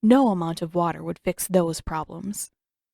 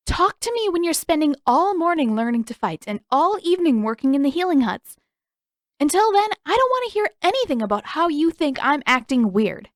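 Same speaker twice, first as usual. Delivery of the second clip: higher and edgy, pushing back; irritated; 8 semitones higher, 3 dB louder than usual. A narrator's reading. Katara snapping at Sokka to drop it. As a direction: snap back at a nosy brother. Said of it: "She glared".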